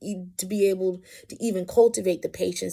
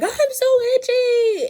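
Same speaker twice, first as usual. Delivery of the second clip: put-on high, loud, drawn-out child's wail; imitated shriek; 6 dB louder, slower than usual. Mom retelling her itchy youngest's bawling, imitated shriek. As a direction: mimic a bawling kid with a long, piercing cry. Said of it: "ear-shrieking scream".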